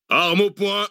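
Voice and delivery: high, drawn-out command shout; shouted military order. A narrator's reading. Mercenary chief orders his men to aim, shouting. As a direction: call it out to the firing squad. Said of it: "ordonna-t-il".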